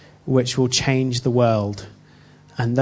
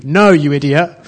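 mock rude refusal; 7 dB louder than usual. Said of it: "how it goes in my head".

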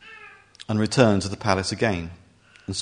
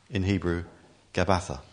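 usual speaking voice

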